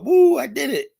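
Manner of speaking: high, loud whoop of victory; whooping cheer. Retold or imitated. Big girl whooping to her friends, high and loud. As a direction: whoop like you just scored.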